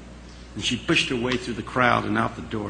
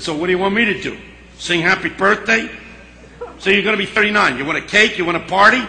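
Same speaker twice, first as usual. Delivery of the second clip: sarcastic jabs that build louder, faster in the middle; mocking friend; 6 semitones higher, 3 dB louder, faster than usual. Friend mocking a birthday, building to a sneer. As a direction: needle her, push each question harder.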